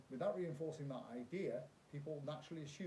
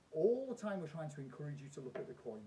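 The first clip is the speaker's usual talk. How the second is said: ordinary delivery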